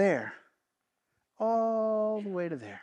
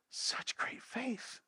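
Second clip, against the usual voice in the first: slow, breathless whisper of awe; hushed whisper; 3 semitones higher, 13 dB quieter, much slower than usual. Acted. Preacher voicing Jesus praising the centurion's faith, whispered. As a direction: whisper it like a secret marvel.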